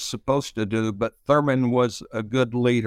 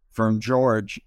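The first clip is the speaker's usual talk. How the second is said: ordinary delivery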